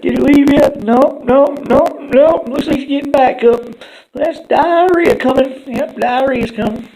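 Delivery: high, loud squeaky voices; cartoon critters. Turd and Fart hiding from Bartholomew, high and loud. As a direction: chirp it like tiny cartoon lookouts. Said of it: "they're like".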